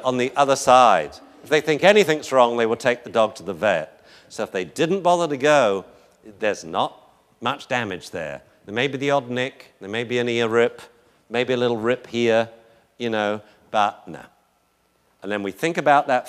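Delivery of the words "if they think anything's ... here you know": ordinary talk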